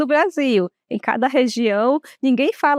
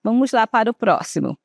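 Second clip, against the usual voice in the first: usual speaking voice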